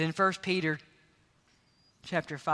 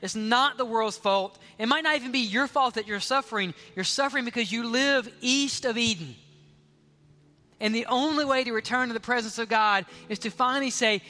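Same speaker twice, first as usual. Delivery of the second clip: higher and louder, urgent altar-call fervour; preaching at full force; 6 semitones higher, 5 dB louder than usual. Acted. Preacher calling sinners to repent, at full force. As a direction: press the call home with rising passion.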